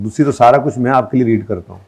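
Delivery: ordinary talk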